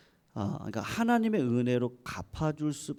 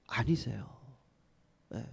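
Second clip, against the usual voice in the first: soft whispered denial; hushed whisper; 4 semitones lower, 8 dB quieter than usual. Real, not acted. Preacher says God is not so, hushed whisper. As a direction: let the answer fall away into a whisper.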